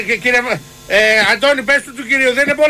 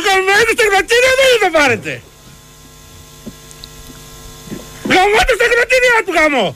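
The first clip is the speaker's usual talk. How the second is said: higher, furious swearing; angry outburst; 7 semitones higher than usual. Real, not acted. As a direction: lose your temper over the dryer. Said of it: "ηρέμησε, [name]".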